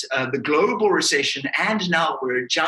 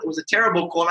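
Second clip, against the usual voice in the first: ordinary talk, nothing marked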